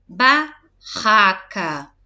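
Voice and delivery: Barraca slow and loud with a strong rr; teaching word. Shown as a demonstration. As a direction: stretch out Barraca, hitting the rr. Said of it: "o R forte".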